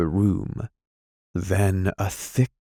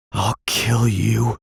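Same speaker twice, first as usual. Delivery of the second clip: gasping mutter; 3 semitones higher, slower than usual. A narrator's reading. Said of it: "muttering in short, broken gasps".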